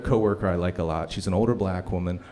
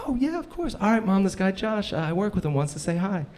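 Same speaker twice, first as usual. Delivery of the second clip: sweet coworker on phone; 9 semitones higher than usual. Retold or imitated. Imitating Shantae on phone.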